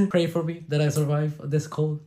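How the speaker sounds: laughing. Real, not acted. Laughing plea about his cold.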